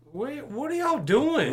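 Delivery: high, disbelieving cry of where, what are y'all doing; incredulous. Real, not acted. To the runaway girls, incredulous high outburst. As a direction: throw up your hands in disbelief.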